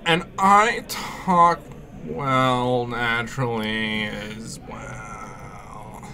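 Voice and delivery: slow creaky vocal fry; vocal fry impression. Shown as a demonstration. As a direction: drag the words out in creaky fry. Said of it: "That's my vocal fry impression".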